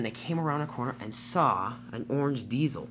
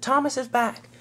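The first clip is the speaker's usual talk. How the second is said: high-pitched welcoming cheer; excited cheer; 11 semitones higher, 4 dB louder than usual. A narrator's reading. Engines cheer Thomas's return.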